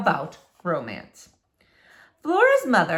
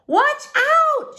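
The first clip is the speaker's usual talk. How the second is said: very high, loud warning yell; shouting through the window at the neighbour; girl's shout; 7 dB louder than usual. Acted. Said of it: "she shouted".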